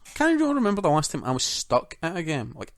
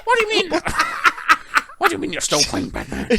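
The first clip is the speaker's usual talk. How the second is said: badly faked Irish Batman voice, jumping in pitch; attempted Irish Batman; 7 dB louder, faster than usual. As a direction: play Batman with a wobbly Irish accent. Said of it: "my Batman voice".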